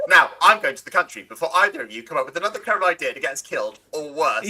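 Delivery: usual speaking voice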